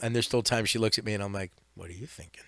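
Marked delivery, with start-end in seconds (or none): 1.8-2.4 hushed whisper. Retold or imitated. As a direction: whisper it under your breath. Retold thought about staring dog.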